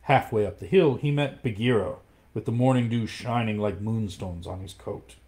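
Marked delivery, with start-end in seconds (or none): none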